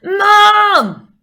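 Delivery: long, high, very loud call for Mom; full-volume drawn-out yell; performed shout. Shown as a demonstration. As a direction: belt it out and hold it. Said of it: "I'm definitely gonna do a".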